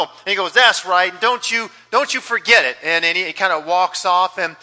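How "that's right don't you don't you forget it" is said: loud, self-satisfied lion; lion boasting to the giraffe; smug lion. Acted. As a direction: puff up and gloat. Lion gloats in story.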